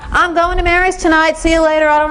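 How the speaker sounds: put-on high, breezy teen voice, cut off; careless teen. Shown as a demonstration. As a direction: brush past the parent, airily.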